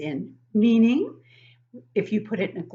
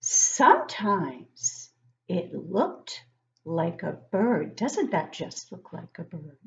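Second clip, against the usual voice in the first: slow, drawn-out storybook reading; reading to children; much slower than usual. Acted. Teacher reading a picture book to young kids, slow and storybook-like. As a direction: read it slowly to the class, savouring each line.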